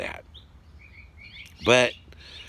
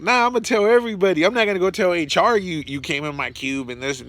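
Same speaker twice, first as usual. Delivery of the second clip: higher, louder, fast playful threat; gleeful teasing; 7 semitones higher, 4 dB louder, faster than usual. Retold or imitated. Telling the scared sock-drying coworker he'll tell everyone, gleefully. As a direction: rattle it off, grinning at the coworker.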